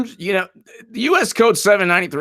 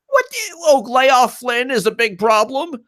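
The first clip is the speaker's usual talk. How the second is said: much higher, scoffing disbelief; incredulous; 9 semitones higher than usual. Real, not acted. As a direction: scoff at the absurd claim. Incredulous outburst.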